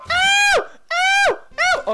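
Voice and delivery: loud, very high pained yelp; piercing cries of ow. Real, not acted. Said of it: "I was screaming like a girl".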